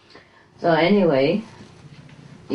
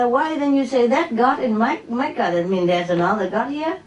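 high, scolding challenge; mild mock scolding of the master; lightly voiced lecturing retort; telling him off; 8 semitones higher than usual. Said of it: "I said".

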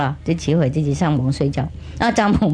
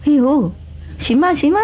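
a hint of children's voices, higher; reluctant yes from the kids; lightly voiced children agreeing; kids giving in; 8 semitones higher than usual. Kids give in.

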